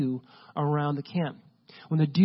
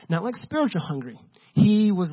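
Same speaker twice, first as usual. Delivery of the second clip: ordinary talk, nothing marked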